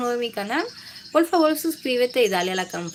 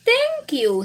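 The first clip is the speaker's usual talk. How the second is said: little cousin voice; 14 semitones higher, 6 dB louder than usual. Acted. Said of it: "he said".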